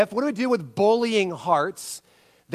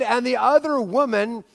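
ordinary talk

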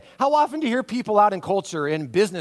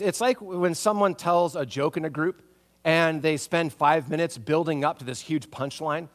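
ordinary talk, nothing marked

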